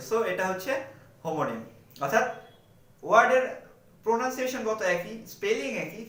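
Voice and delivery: ordinary talk, nothing marked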